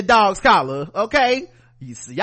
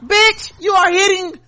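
pitched way up, slow and indignant; outraged high exclamation; 12 semitones higher, 4 dB louder, slower than usual. Real, not acted. Host reacting to the video, high outraged cry. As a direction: let the outrage squeeze the voice up high.

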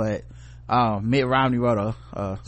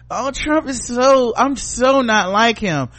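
much higher, slow, louder prissy voice; mock prissy Republican; 8 semitones higher, 5 dB louder, slower than usual. Mocking so-called good Republicans, high slow prissy voice. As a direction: play a smug fake-moral Republican.

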